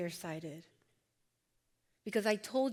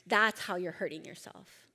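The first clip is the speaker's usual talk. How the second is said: ordinary talk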